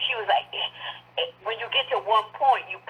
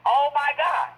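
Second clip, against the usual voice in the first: mock scream retold; 8 semitones higher, 3 dB louder, slower than usual.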